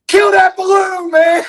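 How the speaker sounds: high, loud frightened shout; panicked scream. Retold or imitated. Screaming at dad to kill the balloon, high panicked shout. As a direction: yell it at your dad in a panic. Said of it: "finally started screaming".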